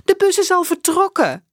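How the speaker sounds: put-on high, loud boy's outcry; despairing shout. Max watching his bus leave, loud and despairing. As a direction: shout the loss to the empty street.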